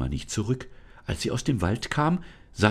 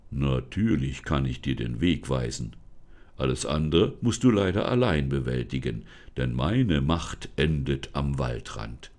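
forest spirit, solemn; 4 semitones lower than usual. Acted. Forest spirit introduces himself to Lisa, low and solemn. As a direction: speak lower as the unseen forest guardian.

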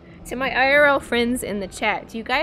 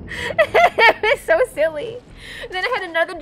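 laughing; 11 semitones higher, 6 dB louder than usual. Real, not acted. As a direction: giggle it out. Laughing while telling.

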